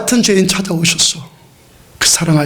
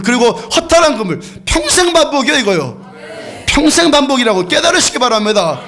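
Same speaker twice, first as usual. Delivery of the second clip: fervent preaching; 7 semitones higher than usual. Acted. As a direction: preach it out with rising fire. Preaching at full force.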